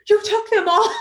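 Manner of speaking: high, loud, amused mock scolding; retold laughing disbelief. Recruiter to candidate who took every Altoid, incredulous and laughing.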